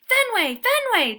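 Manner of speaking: high, loud calls across the lawn; calling out. A narrator's reading. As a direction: shout after the dog. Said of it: "they're calling".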